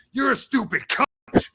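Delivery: loud, high hammered insult; exaggerated hostile yell; mock angry. Shown as a demonstration. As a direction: spit it out like a real attack. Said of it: "But not like".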